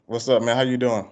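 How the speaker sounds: ordinary delivery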